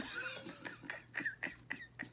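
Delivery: breathy high-pitched squeal of laughter; high airy laugh. Real, not acted. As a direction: wheeze a high silent laugh. Laughing at the shade.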